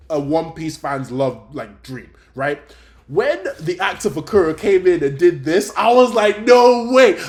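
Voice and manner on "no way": much higher, louder shout of disbelief; excited shout. Retold or imitated. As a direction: blurt it out, thrilled and stunned. Gushing about the Kuro actor.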